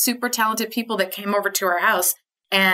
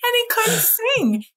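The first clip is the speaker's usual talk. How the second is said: mock high strained voice; 11 semitones higher than usual. Mimicking husband's singing voice.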